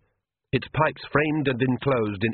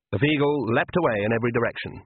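plain reading voice

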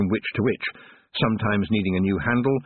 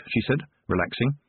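usual speaking voice

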